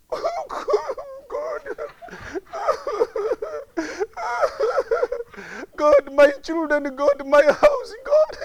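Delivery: hammed-up sobbing prayer; badly faked weeping, breaking high on "God"; mock crying. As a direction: imitate a man bawling to God. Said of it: "Instead of crying".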